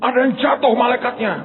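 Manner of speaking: high, alarmed call to dad; imitated high-pitched child's voice; child on phone. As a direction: voice a child reporting an accident. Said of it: "ditelepon anak saya".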